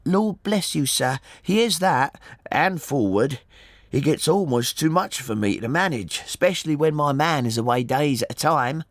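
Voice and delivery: high-pitched woman's character voice; chatty working-class mother. A narrator's reading. Mother tells the gentleman about her forward son, chatty. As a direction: play a talkative boatman's wife, voice lifted.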